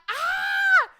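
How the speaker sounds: high shrieking scream; a startled shriek from bed. Retold or imitated. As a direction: woken by a roach, screaming. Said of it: "I was like".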